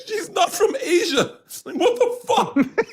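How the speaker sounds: high, slow disbelief breaking into laughter; incredulous laughing. Retold or imitated. Comedian retells his reply to the cook, incredulous laughing.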